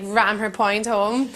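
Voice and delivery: normal talking voice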